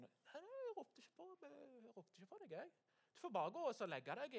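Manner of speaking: high, soft, feeble mumble; sleepy Elia. Acted. Prophet Elia woken by Samuel, soft high sleepy denial. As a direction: answer half asleep, high and quiet.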